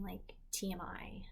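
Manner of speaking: whispered, slow; slow whispered TMI aside. Real, not acted. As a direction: drop to a whisper, embarrassed. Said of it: "how to say this without being".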